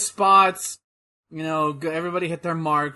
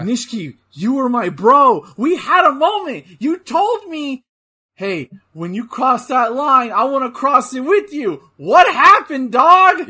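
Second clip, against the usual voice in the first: higher and louder, building to a yell; hurt betrayed outcry; 7 semitones higher, 7 dB louder, slower than usual. Acted. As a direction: plead with a betrayed friend, escalating. Acting hurt Kiryu pleading to Nishiki.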